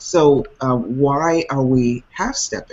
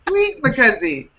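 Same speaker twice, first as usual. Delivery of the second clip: put-on high, playful Afrikaans-accent catchphrase; Mike; 11 semitones higher than usual. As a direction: imitate a buddy's Afrikaans accent, amused. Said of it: "That's my buddy Mike".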